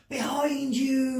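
frightened strained cry, high and slow; urgent warning behind his friend; hoarse whisper. Acted. Ben warning Nat, a frightened hoarse cry. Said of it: "Ben gave a hoarse whisper".

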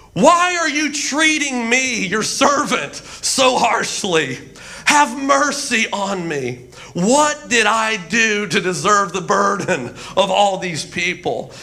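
dramatic, drawn-out plea to God; hammed-up anguished prayer; pleading complaint. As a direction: plead like an overwrought prophet. Acting out Moses' pleading prayer.

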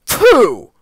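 high, loud dismissive pooh; pooh-pooh it with disdain; sniffy exclamation of disbelief; scornful sniff. A narrator's reading. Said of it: "sniffed Henry".

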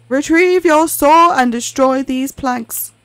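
booming dark order; ominous master. Acted. Master commanding.

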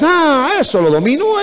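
high-pitched, cocky brush-off; dismissive scorn. Acted. Believer belittles his own sin, dismissive and cocky. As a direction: toss it off with an overconfident 'ah'. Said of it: "¿Por qué menosprecias?".

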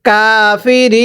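কাফিরিন with the final রি held very long; three-alif stretch. Shown as a demonstration. Teacher models the waqf rule for students, three-alif stretch. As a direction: draw out the last vowel of কাফিরিন before the closing ন. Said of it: "তিন আলিফ টান দিবেন".

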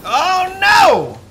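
high loud oh no; alarmed cry. Real, not acted. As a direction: yelp it out. Game alarm.

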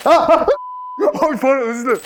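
laughing apology. Real, not acted. Laughing apology after stumble.

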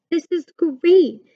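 high, drawn-out delight; laughing delight. A narrator's reading. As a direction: savour the fun, slowly and high.

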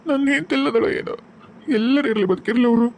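husband's high weeping plea to God; grieving husband. Acted. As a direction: pray brokenly for wife and children. Husband prays for lost wife.

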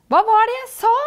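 high, loud character cheer; jubilant. A narrator's reading. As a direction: crow with delight at being right. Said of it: "jubler Hokus".

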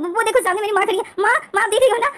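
flustered son calling his mother to look; startled call. Retold or imitated. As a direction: blurt it out, caught off guard. London startled, calls to mother.

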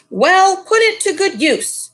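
high, loud bossy command; ordering. Acted. Missus Lee bossing her servants, haughty command. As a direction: order the servants around imperiously. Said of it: "she ordered".

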